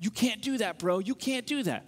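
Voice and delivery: mock indignant scolding, not shouted; mad. Street preachers scolding him behind the booth, mad. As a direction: imitate an angry street preacher telling someone off. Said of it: "And they're really mad".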